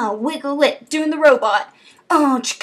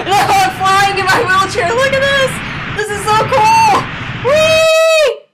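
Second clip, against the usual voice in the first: high, loud gleeful whooping; thrilled shouting; 7 semitones higher, 5 dB louder than usual. Pretend. Player flying in a wheelchair, thrilled shouting. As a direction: whoop with delight, climbing to a squeal.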